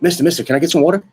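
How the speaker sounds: a pestering student, rushed and high; eager kid. Retold or imitated. Eager student raising hand.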